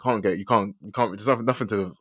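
ordinary talk, nothing marked

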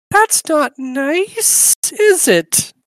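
put-on high, slow eerie little girl; creepy child voice, drawn out; shadow child voice. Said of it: "That was your shadow child voice".

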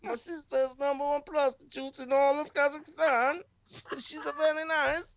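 put-on high Kazakh accent, Borat; Borat impression. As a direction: keep the Borat voice going. Continuing Borat bit.

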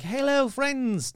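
high, drawn-out bad Scottish greeting; slow, hammed-up Scottish lilt; terrible Scottish accent. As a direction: badly fake a Scottish woman calling to friends. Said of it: "That's a terrible Scottish accent".

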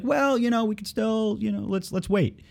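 high, hesitant mock voice; mock dithering officials. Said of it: "and they're like".

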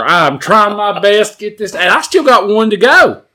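high, loud strained telling; pushing through it, loud and high; animated. Acted. Strained chewing retelling.

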